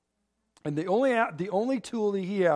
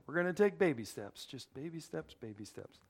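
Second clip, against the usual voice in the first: put-on baby steps fading from normal to much softer; fading away; 3 semitones lower, 11 dB quieter than usual. Shown as a demonstration. Preacher to the congregation, repeating baby steps and trailing off softly. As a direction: let each "baby steps" trail off quieter. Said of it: "repeats the phrase, fading".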